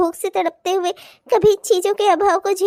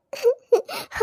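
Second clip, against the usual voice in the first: high, soft sob; 6 semitones higher, 5 dB quieter than usual. Acted. Daughter recalling her mother's suffering, sobbing. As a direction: break into a brief high whimper.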